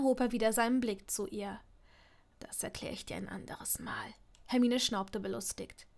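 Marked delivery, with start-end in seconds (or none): 2.4-4.1 very soft, near whisper; hushed evasion. A narrator's reading. Draco puts off explaining his ring, hushed and evasive. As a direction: close the subject under your breath.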